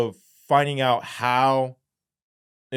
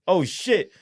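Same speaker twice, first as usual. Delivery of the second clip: high-pitched dawning dread; alarmed, raised voice; retold alarm; 9 semitones higher, 4 dB louder than usual. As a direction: exclaim as the danger hits. Realising the danger.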